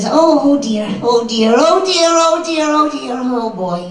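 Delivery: high, loud, fretful repeated oh dear; a nervous boy wringing his hands; Double D voice. Retold or imitated. Showing his Double D character tag, high fretful voice. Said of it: "for Double D, I'll be".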